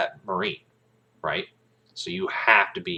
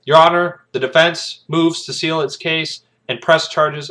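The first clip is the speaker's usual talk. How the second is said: raised, formal courtroom declaration; formal lawyer address; 8 semitones higher, 7 dB louder than usual. Retold or imitated. Kaffee addresses the judge in formal lawyer jargon. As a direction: address the bench with authority. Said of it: "Kaffee's just like".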